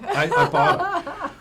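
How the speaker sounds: laughing it out high and slow. Real, not acted. Guest laughing as host names the car barter, laughing. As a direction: echo the word through a big laugh.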